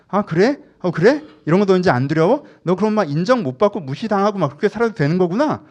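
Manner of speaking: loud, high-pitched badgering as fear personified; yelling fear. Personified fear turns on its owner, yelling threats. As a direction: throw loud, taunting questions at the listener. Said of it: "막 이렇게 애가 막 엄청 소리를 질러요".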